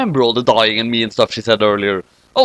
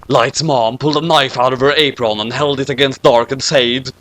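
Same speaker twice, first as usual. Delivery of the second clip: ordinary delivery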